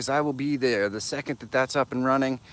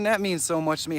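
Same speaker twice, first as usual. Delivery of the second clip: ordinary talk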